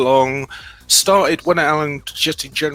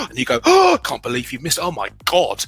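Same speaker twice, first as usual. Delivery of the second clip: gasps then a much higher, hammed-up "can't believe you missed"; mock shocked gasp; 6 semitones higher than usual. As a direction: play it up as a shocked host teasing a child. Acting out a shocked radio host.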